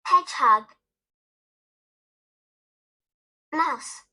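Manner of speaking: each word alone, clear and spaced out, with long gaps; model words for repeating. Shown as a demonstration. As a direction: say each name cleanly so kids can echo it. Repeat-after-me word drill.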